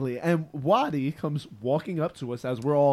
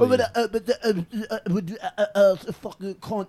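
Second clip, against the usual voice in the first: put-on garbled mumbling British accent, a little higher; mock mumbled accent; 4 semitones higher than usual. Mocking the punk singers with a garbled mumbled accent. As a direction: mumble it like an unintelligible Welsh punk. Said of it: "That's what they both sound like when they talk".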